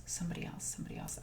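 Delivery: whispered, very soft repetition; muttering to self. Real, not acted. Host can't remember who else went, whispering to self. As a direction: mutter it under your breath while racking your memory.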